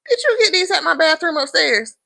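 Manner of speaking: high, loud sarcastic jab. Retold or imitated. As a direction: needle him, pitch high and loud. Bridget mocks fake roses.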